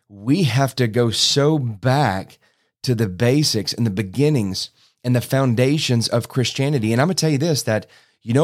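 normal talking voice

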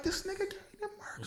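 very high, laughing disbelief; shocked. Retold or imitated. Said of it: "And I was like".

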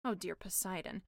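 plain reading voice

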